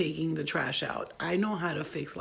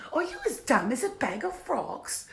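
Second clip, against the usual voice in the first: alternate acting take; 7 semitones higher than usual. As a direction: try it in a high, affected character voice. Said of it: "I will try every different kind of acting".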